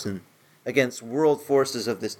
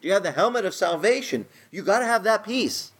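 higher, insistent quizzing of believers; mock pushy churchgoer; 6 semitones higher than usual. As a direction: fire the questions like an eager church member. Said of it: "he said a lot of the Christians today".

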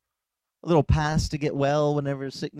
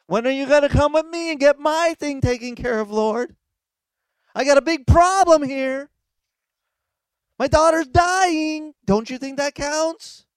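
impatient pleading; 8 semitones higher, 6 dB louder than usual. Acted. Desperate father nagging the Lord to hurry, impatient. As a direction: press the Lord loudly to hurry, pitch climbing.

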